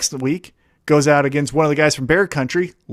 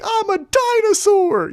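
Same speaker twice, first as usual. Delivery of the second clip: therapy yell; 13 semitones higher, slower than usual. Retold or imitated. Wrestler voicing Luchasaurus's big moment, high slow therapy yell. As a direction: let it out like a breakthrough in therapy. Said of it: "therapy yell of".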